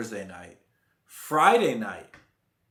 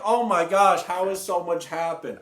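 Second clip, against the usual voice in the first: mock amazed fan; 6 semitones higher, 5 dB louder than usual. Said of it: "you're like".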